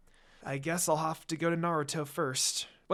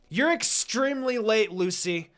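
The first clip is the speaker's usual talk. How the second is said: higher, louder angry scold; furious yell; 6 semitones higher, 4 dB louder than usual. Acted. Sakura scolding Lucy for arriving late, furious yell. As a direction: snap at her for being late. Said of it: "Sakura yells furiously".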